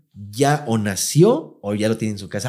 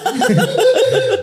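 a shrill burst of laughter, much higher; high squealing laugh. Real, not acted. Carcajada tras chiste de pitos. (chillido de risa muy agudo)

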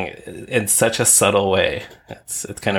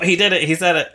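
higher, mock-excited callout; imagined listeners spotting it; 8 semitones higher, faster than usual. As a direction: play a listener catching him at it. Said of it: "they'll be listening and go".

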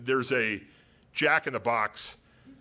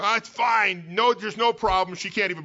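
high, loud, breezy fake-cheerful brush-off; mock reassurance; 9 semitones higher, 5 dB louder than usual. Drunk teen to the driver he rear-ended, falsely breezy. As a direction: wave it off like nothing happened, much higher and louder.

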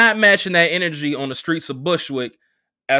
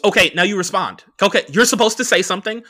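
raised, louder voice, pushing for an answer; aggressive prompting; 6 semitones higher, 4 dB louder, faster than usual. Retold or imitated. Imitating girlfriend demanding he answer her monologue, pushy and aggressive. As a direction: demand a reply, pressing harder and faster as you go. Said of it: "with very aggressive hand gestures towards me".

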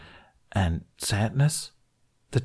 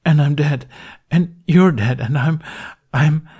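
despairing; 7 semitones higher, 6 dB louder than usual. A narrator's reading. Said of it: "voice muffled against his hands".

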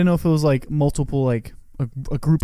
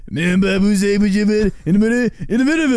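high, garbled jabber; Waterboy coach; 7 semitones higher, 4 dB louder than usual. Retold or imitated. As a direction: mimic an unintelligible movie coach, pitched up. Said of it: "coach just goes".

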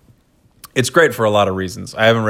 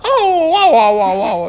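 high cartoon yelps, louder; Mario; 5 dB louder than usual. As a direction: whoop like a hammed-up game plumber. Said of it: "If Mario can do it".

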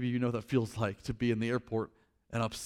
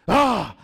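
a loud, high frustrated "Ah!"; angry yell; 7 semitones higher, 11 dB louder than usual. Retold or imitated. Angry passenger at the desk, loud frustrated yell. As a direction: blow up with a wordless roar. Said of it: "But he just said".

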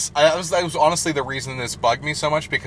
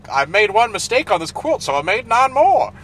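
mock Priscilla voice; 10 semitones higher, 6 dB louder than usual. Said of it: "then just is like".

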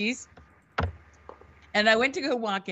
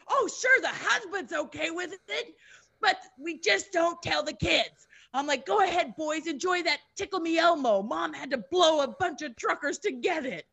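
high-pitched, hammed-up sarcasm; retold hysterical sarcasm, voice pitched up; sarcastic mock rant; 8 semitones higher than usual. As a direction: rant in outraged fake agreement. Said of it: "I'm just screaming".

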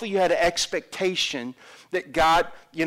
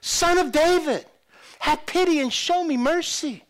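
high, loud plea; beggar's shout; 12 semitones higher, 5 dB louder, slower than usual. Acted. Blind beggar calling to passing Jesus, shouting. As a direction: cry out for mercy over a crowd. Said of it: "The blind beggar shouted".

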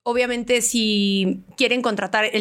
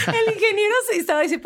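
laughing; 11 semitones higher, faster than usual. Real, not acted. Laughing while retelling anecdote.

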